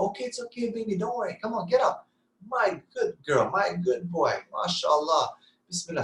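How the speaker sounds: high, gentle encouraging coo; coaxing parent. Retold or imitated. Parent calmly encouraging a fallen child to get up, high and gentle.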